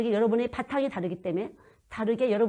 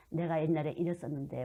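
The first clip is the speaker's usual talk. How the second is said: usual speaking voice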